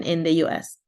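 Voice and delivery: normal talking voice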